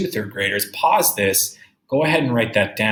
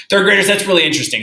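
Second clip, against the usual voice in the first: higher, louder, fast exclamation; teacher excited about a fact; 8 semitones higher, 8 dB louder, much faster than usual. Real, not acted. Teacher marvels to her class.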